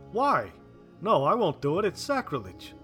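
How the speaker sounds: loud, high-pitched protest; appalled refusal. Acted. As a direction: refuse outright in horror. Weller refuses to open the grave.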